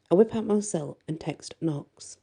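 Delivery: usual speaking voice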